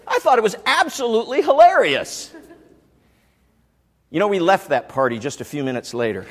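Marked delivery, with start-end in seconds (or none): 0.1-2.3 gleeful. Retold or imitated. Gleefully recalling his own joke.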